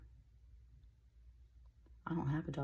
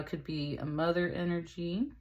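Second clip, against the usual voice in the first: normal talking voice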